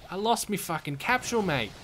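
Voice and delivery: put-on Australian accent, dread setting in; Australian accent, doomed worker telling his mate they're fucked; panicking Australian accent. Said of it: "You do a great Australian accent".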